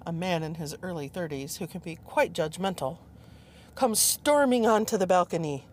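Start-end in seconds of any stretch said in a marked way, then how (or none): none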